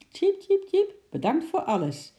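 high cheeps into a high thank-you; chirp, then thank him in a squirrel voice. A narrator's reading. Squirrel thanking his chimp friend, high and chirpy. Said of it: "chirpte Ekie tegen Sjimp".

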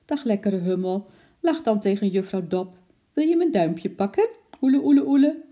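put-on high, cooing voice to a baby; endeared baby talk. A narrator's reading. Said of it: "zei ze vertederd". (vertederd kirrend babypraatje)

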